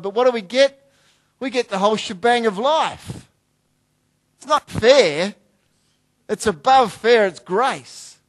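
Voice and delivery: loud, rising preacher's call, hammering each line; full-force preaching. Real, not acted.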